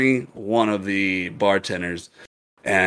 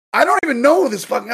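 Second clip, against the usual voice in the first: high-pitched, quick comeback; retold disbelief; 13 semitones higher, 4 dB louder, faster than usual. Bartender reacting to being owned, high retold disbelief. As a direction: replay the indignant reply up high. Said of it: "And I was like".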